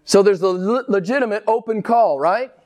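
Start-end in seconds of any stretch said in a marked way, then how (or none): none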